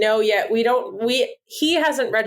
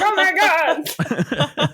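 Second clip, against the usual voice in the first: drawn-out overwhelmed groan; 6 semitones higher, much slower than usual. Retold or imitated. Overwhelmed by voices.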